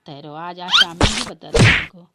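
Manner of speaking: loud threatening yell. Acted. Bhabhi vowing to confront her husband, loud threatening yell. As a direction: bellow the threat.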